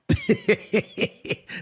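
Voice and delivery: high bright laugh breaking out; burst of laughter. Real, not acted. Laughs at [name]'s jab.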